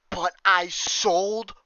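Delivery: high, loud, slow outrage; outraged. Real, not acted. Gamer realizing he sold every tabby, high outraged wail. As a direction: wail it like a cruel joke.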